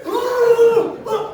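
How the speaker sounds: high shouted woo, way up; mock excited woo. His lady cheering, shouting high. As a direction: whoop like at a party. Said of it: "she was all like".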